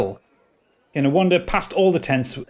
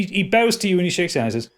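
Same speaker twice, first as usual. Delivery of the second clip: usual speaking voice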